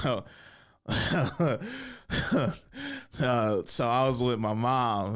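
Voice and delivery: giggly, rambling stoned act; imitated stoner fumbling through a set, trailing into laughs; high comic bombing. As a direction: keep cracking up and losing the thread. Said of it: "I know why he's acting like this".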